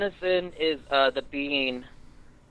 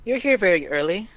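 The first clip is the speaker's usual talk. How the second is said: put-on girl's voice; 6 semitones higher than usual. GM voices the girl.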